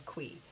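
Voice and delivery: "Qui" as one quick syllable, "kwee"; contracted "can we". Shown as a demonstration. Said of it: "The second time, I just said".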